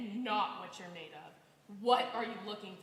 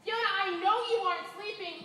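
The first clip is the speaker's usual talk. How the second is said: calling loudly; 7 semitones higher, 5 dB louder, faster than usual. Acted. Banging at tenant's door.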